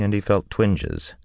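usual speaking voice

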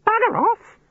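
tiny, very high bird voice; twittering budgerigar. A narrator's reading. Budgerigars mimicking the wizard, twittering. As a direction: twitter it like a cheeky budgie. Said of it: "they twittered".

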